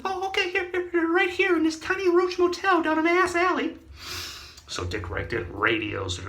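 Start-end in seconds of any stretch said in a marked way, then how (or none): 0.0-3.8 high, put-on cowering voice; high squeaky criminal. Criminal giving up the gnomes in a high voice. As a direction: squeak it out like a scared crook. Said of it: "So I said".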